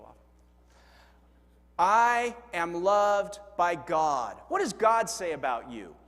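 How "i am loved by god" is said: slow, loud unison declaration; slow group recitation. Shown as a demonstration. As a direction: read it out together slowly and firmly. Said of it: "Let's read 'em together".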